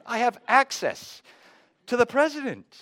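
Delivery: high, loud mock-giddy inner voice; hammed-up starstruck thrill; giddy excitement. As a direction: play up the starstruck cadet. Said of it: "walking away kidding myself".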